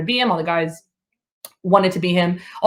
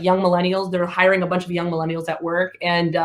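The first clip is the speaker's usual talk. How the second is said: normal talking voice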